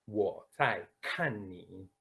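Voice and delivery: slowly, "kan" with a plain unaspirated k; "Wo zai kan ni" with no air burst on "kan"; careful unaspirated Mandarin. Shown as a demonstration. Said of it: "Lo repito".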